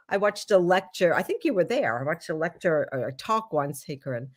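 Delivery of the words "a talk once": normal talking voice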